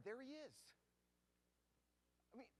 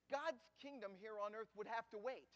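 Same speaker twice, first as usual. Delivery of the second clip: usual speaking voice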